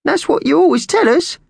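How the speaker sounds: very high, loud bird voice; squawking magpie. Acted. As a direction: squawk it insistently to the boss. Slasher keeps squawking at boss.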